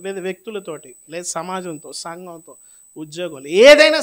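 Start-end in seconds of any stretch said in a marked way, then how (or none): 3.5-4.0 high, loud shout; loud climax. Real, not acted. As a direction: throw it out at full force. Preacher peaks loudly. (గట్టిగా అరిచి)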